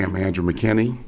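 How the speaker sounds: normal talking voice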